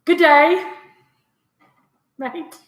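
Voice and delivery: amateur Australian accent, loud opening and a long pause; half-hearted stab at an Australian accent; Australian accent attempt. Shown as a demonstration. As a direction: have a halting go at an Australian greeting. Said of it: "here's my Australian accent".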